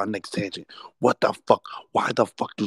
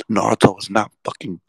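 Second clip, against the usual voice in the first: ordinary delivery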